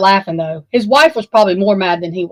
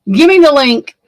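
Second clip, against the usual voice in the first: louder, higher, begging; loud plea; 5 semitones higher, 7 dB louder than usual. Real, not acted. Calling out to Tonka, begging him for the link. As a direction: call out for help, exasperated.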